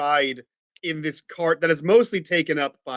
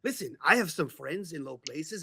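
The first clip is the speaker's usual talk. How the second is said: lightly voiced owl making an offer; shady owl fixer; 8 dB quieter than usual. Owl Jorge offering to procure a bag, lightly voiced shady fixer. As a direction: offer it like an obliging little fixer.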